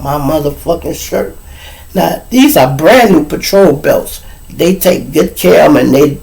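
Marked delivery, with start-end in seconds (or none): none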